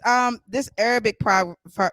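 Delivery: ordinary talk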